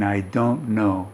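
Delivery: soft, muffled behind hands. Shown as a demonstration. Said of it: "the voice is muffled".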